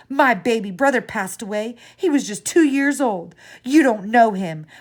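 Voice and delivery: screaming. A narrator's reading. Grieving child screams at the clerk, furious and loud. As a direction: let it all explode at once. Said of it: "I screamed".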